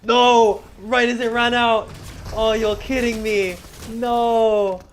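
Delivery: high loud frustrated yelling. Real, not acted. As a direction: yell in real frustration. Game prey escapes, frustrated.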